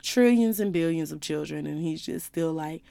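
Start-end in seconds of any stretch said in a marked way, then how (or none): none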